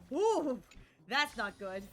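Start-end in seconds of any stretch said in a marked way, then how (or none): 0.1-0.6 excited whoop. Real, not acted. Reacting to bad news.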